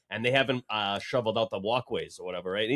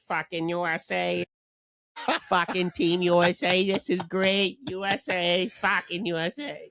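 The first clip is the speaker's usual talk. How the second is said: higher, hammed-up Boston fan cheer with laughs; imitating Boston fan; 6 semitones higher than usual. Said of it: "He's like".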